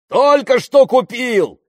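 very high, loud, slow holler; yelling. A narrator's reading. Pilot showing off his new plane, yelling. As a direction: bellow it out with proud excitement. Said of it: "заорал он".

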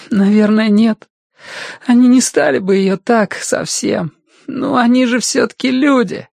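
put-on very high, crying boy's voice; tearful protest. A narrator's reading. Crying boy doubts his friend's suspicion, tearful. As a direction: plead through tears in a high voice.

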